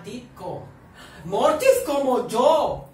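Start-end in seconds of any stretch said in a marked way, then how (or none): 1.2-2.9 loud, high, drawn-out yell; big stretched-out exclamation; excited shout. Real, not acted. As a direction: belt it out slowly. Excited outburst about the name.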